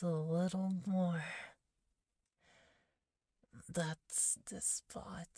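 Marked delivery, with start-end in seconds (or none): 3.7-5.3 slow soft whisper. Acted. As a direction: breathe it out blissfully. Enjoying head pat, whispered.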